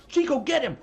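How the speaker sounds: high, loud shouted order to the dog; mock shouted command. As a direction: yell it like siccing a dog on someone. Owner sets dog on Craig.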